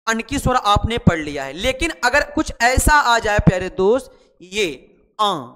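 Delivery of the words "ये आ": आ drawn out long and slow with a nasal vowel; nasal aa demo. Shown as a demonstration. Teacher contrasting अन with आ for pupils, slow nasal. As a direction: hold आ as a long nasal sound. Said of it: "अन और आ में बहुत अंतर है".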